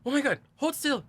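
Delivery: high, loud mock alarm; alarmed warning. Boyfriend warning about a hornet on his head, alarmed.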